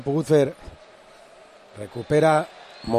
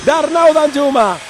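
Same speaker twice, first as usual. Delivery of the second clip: goal announcement; 9 semitones higher, 7 dB louder, slower than usual. Real, not acted. Naming the scorer after goal.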